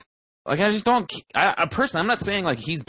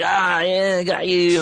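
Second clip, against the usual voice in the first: excited, slightly raised voice; mock gloating; 5 semitones higher, 3 dB louder, much slower than usual. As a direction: gloat like a player who just scored. Acted gloating opponent in retold game.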